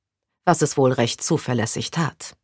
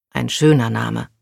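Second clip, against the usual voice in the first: ordinary delivery, nothing marked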